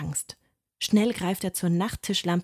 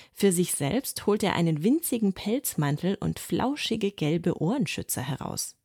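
ordinary delivery

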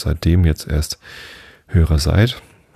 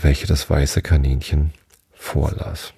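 ordinary delivery

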